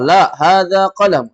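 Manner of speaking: slow teacher's model; teacher leads class in answer; slow model phrase. Shown as a demonstration. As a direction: lead the repetition slowly. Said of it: "Ayo semua".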